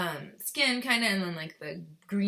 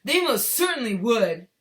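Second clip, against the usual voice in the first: Mister Gloop, indignant; 4 semitones higher, 10 dB louder than usual. A narrator's reading. Father insisting people would buy it, indignant. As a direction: protest loudly, less high, voice put on. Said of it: "cried Mister Gloop indignantly".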